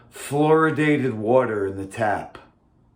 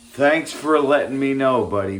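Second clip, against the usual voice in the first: sarcastic; 5 semitones higher, 6 dB louder than usual. Real, not acted. Sarcastic thanks to no-show.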